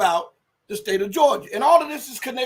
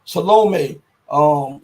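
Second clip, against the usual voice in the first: ordinary talk, nothing marked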